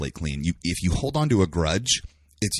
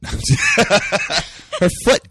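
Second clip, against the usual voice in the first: stretched, much higher, laughing it out; 8 dB louder than usual. Real, not acted. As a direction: drag out the word while laughing. Cheeky joke, laughing.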